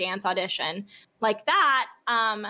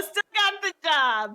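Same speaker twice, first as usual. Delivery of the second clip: higher-pitched, drawn-out triumph; gleeful boast; 7 semitones higher, slower than usual. Real, not acted. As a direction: crow about landing it despite the fall. Gleeful boast after telling of fall.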